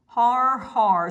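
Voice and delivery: loud hammed-up "har, har" laugh; storybook robber's loud taunting "har, har"; mocking pirate laugh. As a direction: bark out a mock villain's laugh. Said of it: "cried Sid Rat".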